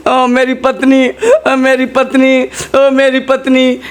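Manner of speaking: hammed-up slow high lament; mock wailing. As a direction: wail for the lost wife, half laughing. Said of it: "अब ये रोने लगे". (पत्नी के लिए नाटकीय, बनावटी विलाप)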